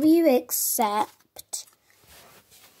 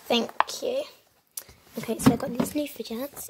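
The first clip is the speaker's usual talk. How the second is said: usual speaking voice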